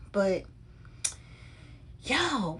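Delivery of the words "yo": usual speaking voice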